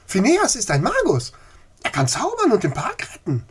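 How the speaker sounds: high, loud eager blurt; bursting out. Acted. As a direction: burst out with the secret. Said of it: "Aus Sophie platzte es förmlich heraus".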